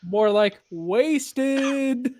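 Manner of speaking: loud, hugely stretched pun; mock-triumphant drawl on the joke; drawn-out punchline. Punning joke on "hasted.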